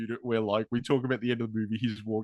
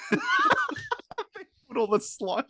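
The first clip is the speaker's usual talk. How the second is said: laughing; much slower than usual. Real, not acted. Laughing at the absurd scene.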